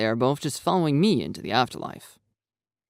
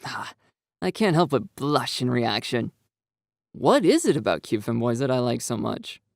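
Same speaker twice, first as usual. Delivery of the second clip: usual speaking voice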